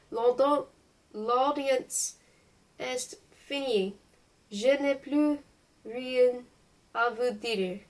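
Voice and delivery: measured, formal French dismissal; precise, old-fashioned French, word by word; brusque, precise French. A narrator's reading. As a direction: narrate a brusque uncle ending the audience. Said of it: "in his brusque, precise, and somewhat old-fashioned French".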